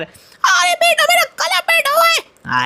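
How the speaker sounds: a man faking a croaky high aunty, stretched out slowly; put-on aunty voice. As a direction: play an aunty whose throat is sore, high and dragged out. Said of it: "आपकी आवाज़ में दम नहीं है".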